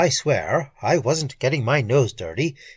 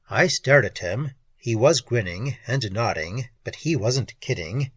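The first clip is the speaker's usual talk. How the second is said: usual speaking voice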